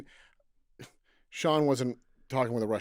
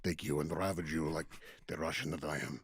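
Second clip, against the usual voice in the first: deep, low mock Russian accent, softer at the end; 9 semitones lower, 11 dB quieter than usual. Podcast host mocking the wrestler on his girlfriend, low Russian accent. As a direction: growl it like a hammy Russian wrestler. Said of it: "Here's clip number two".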